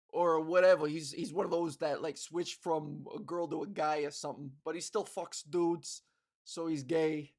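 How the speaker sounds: low-pitched New York sports fan; loud New York accent. Acted. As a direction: hold forth in a New York accent at the ballgame. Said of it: "somebody with that accent, just talking loudly".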